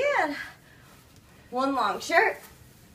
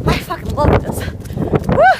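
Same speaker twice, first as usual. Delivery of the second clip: excited; 5 semitones higher, 4 dB louder than usual. Real, not acted. Thrilled out in the blizzard.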